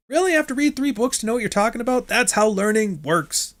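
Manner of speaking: high-pitched mock incredulity; exaggerated objection and retort, pitched well up; put-on high voice, scoffing; mock exchange. Mocking objection to reading three books.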